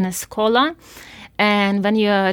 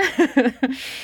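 real laugh; 4 semitones higher than usual. Real, not acted. Laughing telling mom.

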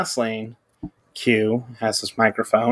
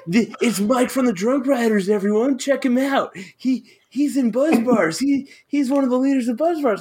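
much higher, giddy hype announcement; mock hype man; 11 semitones higher than usual. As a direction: hype him up to the crowd like a goofy emcee. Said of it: "[name]'s being my hype man".

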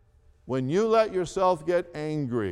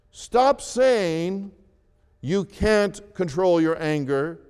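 ordinary delivery

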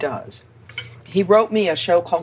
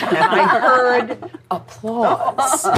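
slow, laughing through the words; 6 semitones higher, much slower than usual. Real, not acted. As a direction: chuckle as you say it. Laughing at the memory.

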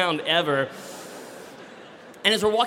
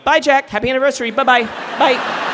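much higher, much louder frantic goodbyes; panicked goodbye; 9 semitones higher, 7 dB louder than usual. Retold or imitated. David saying goodbye to Jack before the massage, frantic.

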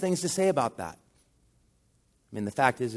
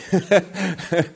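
laughing; 5 semitones higher, 6 dB louder than usual. Retold or imitated. Laughing at forgotten introduction.

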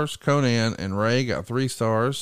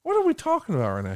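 high-pitched incredulous questioning; squeaky mock disbelief; retold bafflement; 4 semitones higher, faster than usual. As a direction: act out total confusion, voice shooting up. Retold baffled reaction to the story.

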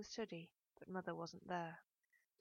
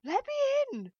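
very high, loud, desperate cry; high-pitched wail; 14 dB louder, slower than usual. A narrator's reading. Worked-up mother rattles daughter's doorknob, wailing shrilly. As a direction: wail it through the locked door. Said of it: "it was such a high-pitched wail".

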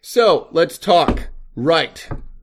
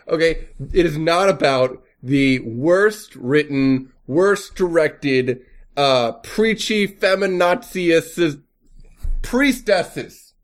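slow, word-by-word rant; slow emphatic; slower than usual. Real, not acted. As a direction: lean hard on every word, slowly.

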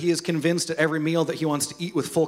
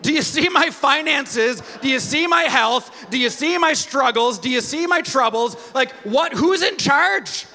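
whining believer; 7 semitones higher than usual. Retold or imitated. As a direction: plead like a toddler with God. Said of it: "we're like".

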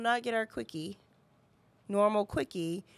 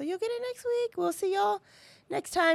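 bright sign-off; 11 semitones higher than usual. Real, not acted. Cheery podcast sign-off.

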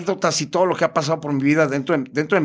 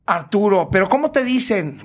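higher, campy puzzled question; imitated performer; 4 semitones higher than usual. Performer asks the caller for a nickname, high camp questioning. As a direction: query the name in a put-on high voice. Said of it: "me pregunta".

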